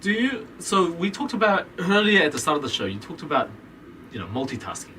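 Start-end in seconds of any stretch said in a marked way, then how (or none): none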